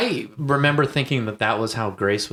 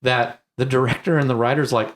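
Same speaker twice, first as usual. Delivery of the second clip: usual speaking voice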